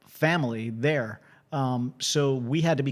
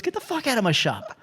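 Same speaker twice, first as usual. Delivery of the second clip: a higher, put-on voice snapping get the fuck out; gruff mock order; 7 semitones higher than usual. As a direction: play the annoyed shop guy kicking him out. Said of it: "he goes".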